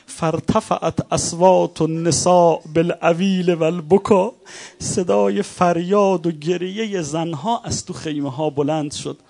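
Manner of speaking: impassioned preaching. Acted. Preacher describing the women's cries from the tents, impassioned. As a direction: let the lament swell into the wailing.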